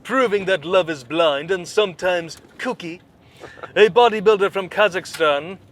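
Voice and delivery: mock anchor voice, rising into the story; hammed-up newscaster read; news read. Reads a news story aloud.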